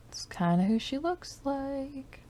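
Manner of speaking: high, quiet self-repeat; high, soft echo. Real, not acted. Musing to herself about the Sim, high and soft.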